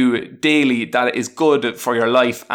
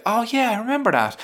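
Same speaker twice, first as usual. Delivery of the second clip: pleased recognition; 10 semitones higher than usual. Pretend. Flicking through memos.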